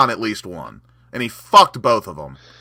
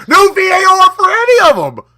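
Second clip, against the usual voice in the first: much higher and louder, yelling in outrage; furious shout; 9 dB louder than usual. Real, not acted. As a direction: bellow it at the ref like a wronged fan. Ranting about the referee's decisions.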